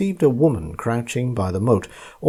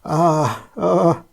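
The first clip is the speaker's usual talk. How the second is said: terrified higher cry, rising to a yelp; 7 semitones higher than usual. A narrator's reading. Fleeing merchant crying out in terror. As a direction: gasp out in fright. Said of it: "crying out".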